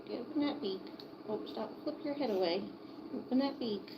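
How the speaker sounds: high, soft coaxing voice; soft coaxing pet talk. Real, not acted. Owner coaxing her chick to open its beak, high and soft. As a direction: gently wheedle a stubborn chick.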